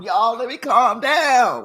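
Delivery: ordinary talk, nothing marked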